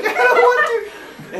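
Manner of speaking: high loud whining protest; whiny protest. Retold or imitated. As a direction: kid pushed forward by the fourth grader, high whining protest. Whining while pushed.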